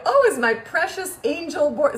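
high, slow, sugary sarcasm; mock-sweet cooing. Mom answering her bored son, sarcastically doting. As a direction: coo at the child with syrupy mockery.

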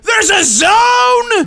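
slow, high, loud shocked yell; surprised shout. Real, not acted. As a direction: blurt it out in shock. Surprised by the zone.

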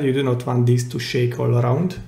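ordinary talk, nothing marked

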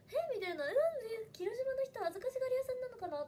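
high-pitched acted bewilderment; puzzled reaction. New girl in Hiroshima wonders why nobody joins in, puzzled. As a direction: wonder it aloud, pitched well up.

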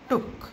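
टुक with a quick, short "oo"; short vowel. Shown as a demonstration. Teacher showing children a short double O, टुक said short. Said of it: "अरे भाई short है".

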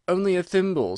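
plain reading voice

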